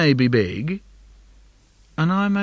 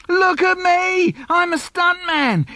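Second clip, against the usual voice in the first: put-on much higher, slower boyish yell; proud shout; 3 dB louder, slower than usual. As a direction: shout it mid-jump to show off. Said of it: "I shout".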